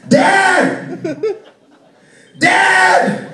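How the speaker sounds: put-on high, loud kid hollering for Dad; screaming kid. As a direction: scream it like a small boy across the house. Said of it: "he starts screaming".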